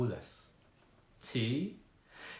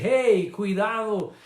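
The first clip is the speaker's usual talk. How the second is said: loud, raised warning call; 5 semitones higher, 5 dB louder than usual. Acted. A voice warning the church that the world has an owner, warning call. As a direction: call out a high, urgent caution. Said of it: "una voz que dice".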